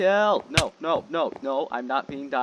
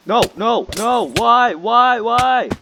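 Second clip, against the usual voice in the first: panicked yelling; 10 semitones higher, 12 dB louder, much slower than usual. Real, not acted. Being killed in game.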